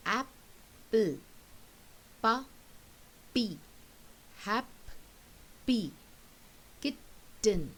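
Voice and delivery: "apple, puppy, happy, kitten", each word broken by a pause in the middle; syllables apart. Shown as a demonstration. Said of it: "take the two syllables apart".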